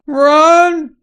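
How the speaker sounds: loud high urgent yell; sharp high shout of alarm; shouted run. Real, not acted. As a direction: scream at them to flee. Sudden danger in game.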